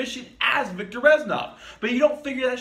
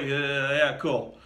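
low, hesitant brush-off; a touch of awkward doubt, low; mock unconvinced reply; 6 semitones lower, much slower than usual. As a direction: imitate someone humoring a madman, pitched down. Quoting Woods's doubtful reply.

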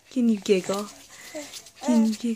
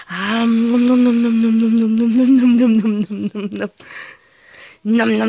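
loud, playful chomping noises; munch the toes loudly and steadily; nom nom chomping; 6 dB louder than usual. Pretend. Parent chomping on baby's toes.

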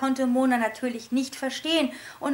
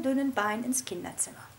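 ordinary delivery

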